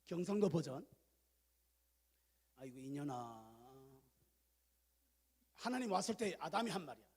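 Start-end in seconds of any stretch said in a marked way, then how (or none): 2.6-3.9 low, slow groaning Gyeongsang reproach; acted Gyeongsang exasperation, drawn out and low; Gyeongsang dialect. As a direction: sigh it out softly in Gyeongsang speech. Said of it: "경상도 버전으로 이렇게 했어".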